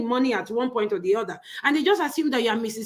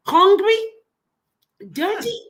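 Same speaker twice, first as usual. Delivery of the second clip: scornful emphasis; 7 semitones higher, 6 dB louder than usual. Real, not acted. Mocking the groom's looks.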